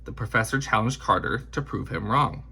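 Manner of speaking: usual speaking voice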